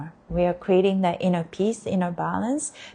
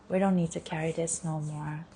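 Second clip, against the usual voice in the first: quieter, gentle repeat of the line; soft repeat; 3 semitones lower, 8 dB quieter than usual. Shown as a demonstration. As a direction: say it again gently and softly. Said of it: "repeated softer".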